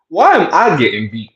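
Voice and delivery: put-on high, whiny little-kid protest; six-year-old voice. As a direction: complain like a small boy who feels it's unfair. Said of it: "In my six-year-old voice".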